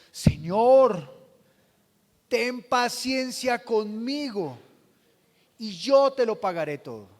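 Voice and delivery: loud, drawn-out plea for mercy; pleading servant. Acted. Servant on his knees begging the king, pleading. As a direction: beg the master slowly and loudly.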